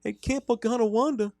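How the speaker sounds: put-on high-pitched Wisconsin hockey accent; high, cheery Midwestern creep; creepy camp counselor. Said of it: "Sell it".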